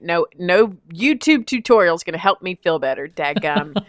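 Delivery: ordinary talk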